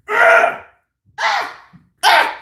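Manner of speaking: short, high bursts of pretend rage; angry mock yells. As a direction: let out playful frustrated yelps. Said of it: "I'm angry".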